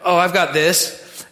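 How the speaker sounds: slow, easy and unbothered; relaxed quoted confidence, loud; casual Jesus voice. Retold or imitated. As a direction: toss it off like nothing can touch you. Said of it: "he was like".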